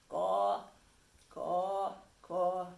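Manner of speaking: loud parting caws; crow caws. Acted. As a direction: call out as the crow. Crow calls flying off.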